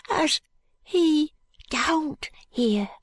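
very high, wavering fearful urchin. A narrator's reading. Jo doubting Allan's promise, high fearful voice. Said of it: "shaking his head fearfully".

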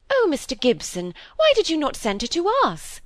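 high, rising protest; eager interruption. A narrator's reading. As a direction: break in with a pleading reproach. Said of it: "broke in Miss Browning".

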